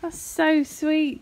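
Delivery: mother thanking her son for his card; delighted gushing. Real, not acted.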